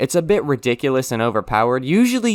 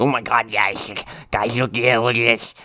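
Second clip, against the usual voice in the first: mock excited nerd. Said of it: "No, we didn't say any of that".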